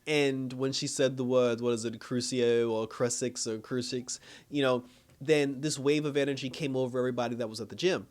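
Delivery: ordinary talk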